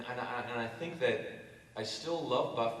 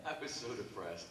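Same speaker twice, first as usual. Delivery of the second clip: usual speaking voice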